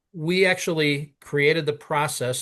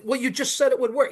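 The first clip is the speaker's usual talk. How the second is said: much higher-pitched disbelief; exasperated protest; 11 semitones higher than usual. Retold or imitated. Caller protests the man's sudden no, exasperated. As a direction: object as if completely thrown.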